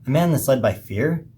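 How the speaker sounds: speaking through hiccups. Real, not acted. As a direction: talk while hiccuping. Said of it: "sorry, I'm hiccuping here".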